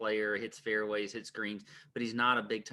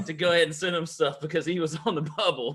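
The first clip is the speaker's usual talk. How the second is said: głośno i wysoko, z niedowierzaniem; incredulous rant; 5 semitones higher, 6 dB louder than usual. Retold or imitated. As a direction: rant about this bold guy, louder and higher. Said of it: "and I'm thinking".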